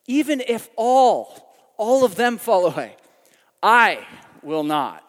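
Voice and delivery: loud, slow vow with weight on each phrase; overconfident, ringing declaration; emphatic, bold Peter. Acted. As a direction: proclaim it boldly, breaking it up for emphasis. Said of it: "more and more emphatic and more and more bold".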